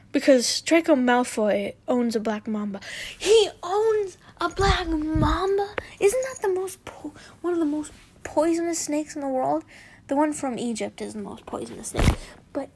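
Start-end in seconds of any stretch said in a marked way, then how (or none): 3.2-9.6 real disbelief, pitched high; incredulous. Real, not acted. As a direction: react astonished, stretching the first words high. Reader reacts in disbelief to story.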